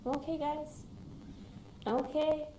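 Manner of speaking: calm worried parents over the phone; reassuring family. Retold or imitated. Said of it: "and I'm just like".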